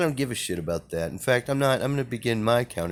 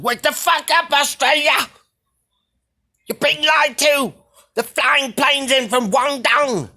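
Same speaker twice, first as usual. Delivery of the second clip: very high, very loud bellowing outrage; furious ranting shout; 9 dB louder than usual. Retold or imitated. Imitating an angry Australian man shouting at his country. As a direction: scream it like a man fed up with lies. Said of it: "He's mad as hell".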